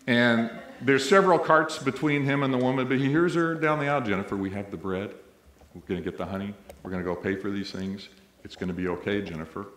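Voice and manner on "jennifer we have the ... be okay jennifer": soft, low and soothing, much quieter; very calmly. Retold or imitated. Mother soothing her screaming child in a deli, very calm and quiet. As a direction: lower and softer, steady and unhurried to calm a child. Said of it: "the woman say very calmly".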